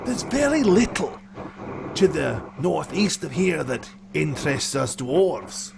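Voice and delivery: lightly voiced dwarf; dwarf guide. Dwarf answers about the path.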